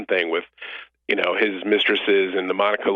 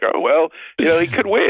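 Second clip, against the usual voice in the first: mock resigned; 7 semitones higher than usual.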